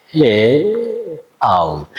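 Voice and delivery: "hill" broken into hih and ul; slow-motion "hill", ending on a dark L. Shown as a demonstration. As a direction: stretch and separate the glide in "hill". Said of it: "and then a".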